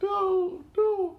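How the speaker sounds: very high mock-frightened squeal; mock terrified plea. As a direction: ham up the scared mother's pleading. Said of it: "She's also like".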